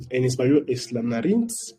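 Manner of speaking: usual speaking voice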